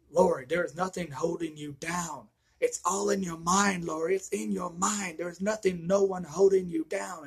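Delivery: lightly voiced doctor talking sense into her; urgent Loomis. Loomis urging Laurie to snap out.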